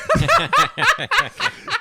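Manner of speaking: loud high burst of laughter; real laugh breaking out; loud high-pitched laugh; big laugh. Real, not acted. Laughing at the punchline.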